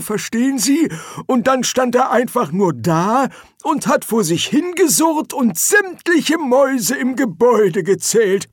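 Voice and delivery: put-on high old man's voice; excitable old wizard. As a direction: explain eagerly in a high-pitched codger voice. Figure: old wizard explaining.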